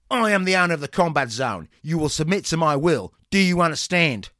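loud barked commands; drill sergeant. Acted. Trainer barking again.

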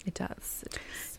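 hushed unvoiced whisper; spooky whisper. Real, not acted. Agreeing it gets spooky, a hushed whisper. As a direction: whisper it like a ghost story.

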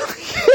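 a short high burst of laughter. Real, not acted. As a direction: laugh at your own joke. Laughs after his joke.

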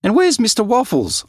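high, loud alarmed shout. Acted. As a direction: demand answers at the top of the voice. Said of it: "I shouted".

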